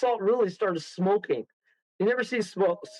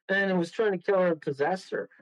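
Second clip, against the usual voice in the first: ordinary delivery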